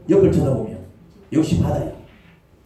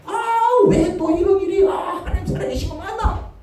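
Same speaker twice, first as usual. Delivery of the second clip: high, loud mock outcry; frantic complaint; 3 dB louder than usual. Believer protesting to God in a high, loud mock outcry. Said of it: "방방 뛰지요".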